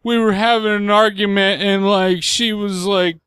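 high, slow, teary retelling; crying voice. Retold or imitated. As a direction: imitate a dad crying on the phone. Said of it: "I'm trying to do crying voice".

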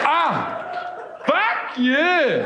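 high, indignant South African retort; South African accent. Acted. Girlfriend cursing boyfriend in a row, indignant South African accent. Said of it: "I will now be doing a South African accent".

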